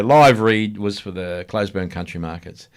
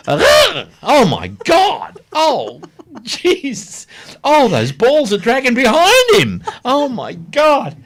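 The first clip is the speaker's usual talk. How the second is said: imitating the audience's horrified gasps, much higher and louder; mock shocked audience; 5 dB louder, much slower than usual. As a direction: squeal like a scandalised crowd seeing something indecent. Audience shocked at naked man.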